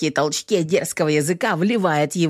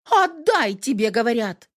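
high, demanding female voice; angry woman; 7 semitones higher than usual. A narrator's reading. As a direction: stamp and demand it back. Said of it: "Притопнула опять ногой злющая-злющая Кэтрин".

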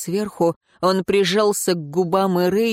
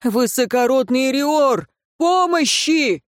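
much higher, slow desperate cry through a door; calling for help; 6 semitones higher than usual. Acted. A peasant begs the advisor for help through the door. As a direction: yell for help from outside.

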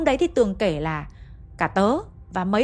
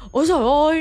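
high, much louder shriek on "giời"; terrified shriek; 6 semitones higher, 8 dB louder than usual. Retold or imitated. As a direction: scream "Ôi giời ơi" in pure panic. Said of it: "hét lên một tiếng rất là to, kiểu thất thanh".

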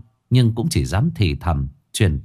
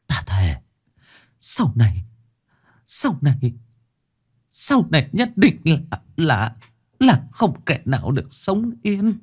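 halting gasps rising to a high, strained vow; broken, faltering oath, climbing in pitch; stammered dying curse; 7 semitones higher than usual. A narrator's reading. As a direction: stammer out a curse with your last breath. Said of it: "trong miệng lắp bắp nguyền rủa".

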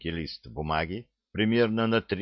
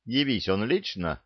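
plain reading voice

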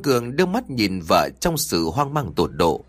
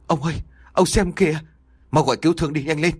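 higher, urgent frightened plea; a wife's higher, pleading panic; trembling voice; 6 semitones higher, faster than usual. Acted. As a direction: urge husband to hurry, voice shaking with fear. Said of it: "nói với một giọng run rẩy".

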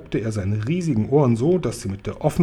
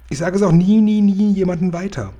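high, loud, insistent vow; earnest pledge, hammering each repeat; emphatic promise; 7 semitones higher, 5 dB louder than usual. Acted. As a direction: swear it eagerly, voice pitched up. Child promises to keep the secret.

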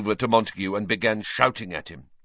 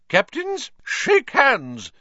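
high, commanding referee call; stern referee; 14 semitones higher, 4 dB louder, slower than usual. A narrator's reading. Madam Hooch starts the match, commanding call. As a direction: order the captains briskly. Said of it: "said Madam Hooch".

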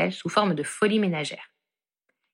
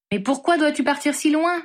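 higher, a touch of a mother's dismay; distressed; 8 semitones higher, 3 dB louder than usual. Acted. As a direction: plead gently, a little upset. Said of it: "se désola missus Weasley". (mère désolée, avec une légère plainte)

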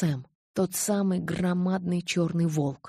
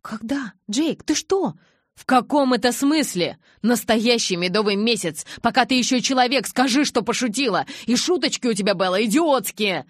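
horrified outburst; 4 semitones higher, 8 dB louder than usual. Acted. Jacob horrified and furious.

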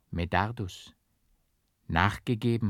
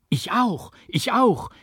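very high, louder eager cries; voices calling over each other; 13 semitones higher, 6 dB louder than usual. A narrator's reading. Several churchgoers chime in, high and eager. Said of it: "riefen mehrere Stimmen durcheinander".

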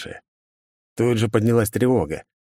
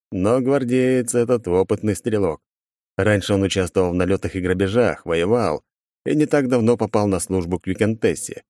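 ordinary delivery

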